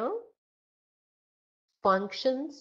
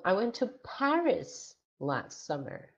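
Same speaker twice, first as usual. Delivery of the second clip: Paris raised and emphasized, the rest lighter; stress on Paris; 2 semitones higher than usual. Shown as a demonstration. Answering where did you go, stress on Paris. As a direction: make Paris the key word. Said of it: "So the stress word is Paris".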